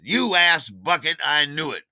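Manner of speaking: loud, high mock outburst; angry accusation. Gregory hears about the glass of wine, angry accusation. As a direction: act out an indignant told-you-so.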